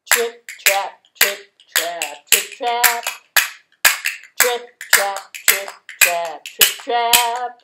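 trip trap. Acted.

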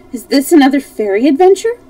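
loud, high wondering question; exclaimed. A narrator's reading. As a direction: ask in lively surprise. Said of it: "exclaimed Dorothy".

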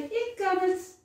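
high, proud cry of yes; girl proudly calling out to the doctor; glad shout. A narrator's reading. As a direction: burst out happily. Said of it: "roept Saar blij".

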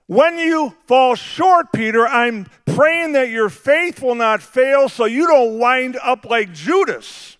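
ordinary delivery

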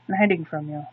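usual speaking voice

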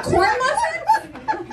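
very high, drawn-out incredulous question; baffled disbelief. Retold or imitated. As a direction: stretch it upward, utterly bewildered. Baffled reply to mother's order.

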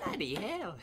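put-on high girly British accent; mock a British girl swearing. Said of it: "I suck at girl impressions".

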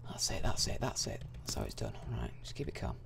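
soft low whisper; hushed calm. Real, not acted. Gamer whispering himself steady through the round. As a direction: murmur to yourself, steady. Calming himself while playing.

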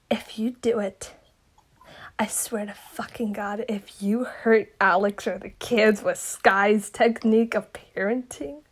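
unvoiced, menacing whisper; whispered threat. Warning a friend not to hurt the kids, a whispered threat. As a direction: hiss the warning under your breath.

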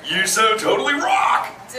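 rising crowd-rousing cue; rallying call. Shown as a demonstration. Turtle cues everybody to scream back, rousing rising call. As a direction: rev up the crowd, pitch climbing to the end. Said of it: "Here we go".

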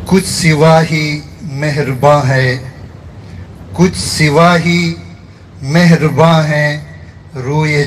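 measured mushaira recitation, line by line; poetry recitation. Acted. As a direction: recite the verse in separate phrases, lingering on the refrain. Recites own poem at a gathering. (मुशायरे के अंदाज़ में शायरी)

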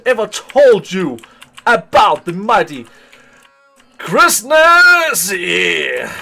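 loud, drawn-out announcer voice; grandiose announcer. Acted. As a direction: boast like a ring announcer. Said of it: "grand announcement of himself".

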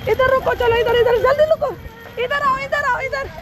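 gather them in, fast; urgent commands. Real, not acted. Herding children in storm.

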